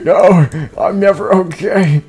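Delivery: gasp, then high, slow, frightened whine; high panicky fear. Acted. Frightened little fella answering his caretaker, high slow panicky whine. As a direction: play it scared out of your wits. Fearful voiced character answers.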